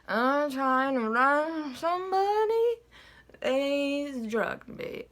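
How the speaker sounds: put-on slow, slurred drunk voice. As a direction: draw it out like you're tipsy. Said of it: "That's my drunk voice".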